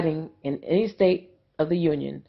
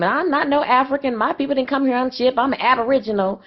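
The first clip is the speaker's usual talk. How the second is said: lightly voiced, higher mocking quote of a claimant; 8 semitones higher than usual. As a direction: parody those who deny African roots, pitched up. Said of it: "for those of you that claim".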